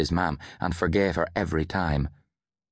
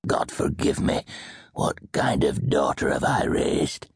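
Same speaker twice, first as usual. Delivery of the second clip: low, slow, gruff grandfather; gruff old man; 3 semitones lower, slower than usual. A narrator's reading. Grandfather despairing of his daughter, gruff and slow. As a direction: ask it gruffly, weighed down. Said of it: "he asked gruffly".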